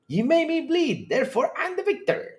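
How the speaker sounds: high pompous movie quote; high mock movie voice. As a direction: declare victory in a high mock voice. Quoting movie character.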